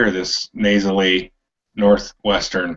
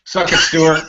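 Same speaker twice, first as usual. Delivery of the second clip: playful taunt; 7 semitones higher, 5 dB louder than usual. Pretend. Teasing jab at friend.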